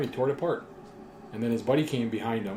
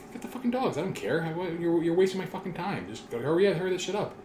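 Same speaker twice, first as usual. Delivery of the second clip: much higher, impatient, exasperated; defiant snap; 7 semitones higher, 3 dB louder than usual. Retold or imitated. Driver telling the cop to hurry, mock irritated. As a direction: brush the cop off, fed up.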